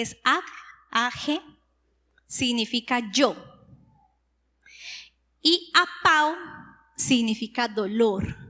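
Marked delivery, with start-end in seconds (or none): none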